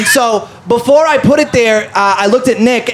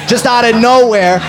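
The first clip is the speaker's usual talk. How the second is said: ordinary talk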